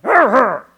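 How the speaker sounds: hammed-up wordless growls of frustration; exasperated double growl. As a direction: growl twice in comic exasperation. Repeated exasperated reaction, bigger.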